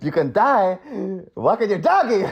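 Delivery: hammed-up, high-pitched movie impression; Al Pacino. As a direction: burst it out in a cartoonish actor voice. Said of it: "I gotta give it to Pacino".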